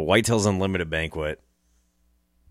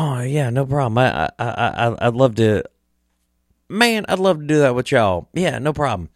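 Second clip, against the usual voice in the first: higher-pitched mock eager yes; imitating an enthusiastic guest; eager agreement; 6 semitones higher than usual. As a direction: act out his easygoing, keen acceptance. Retelling his keen agreement.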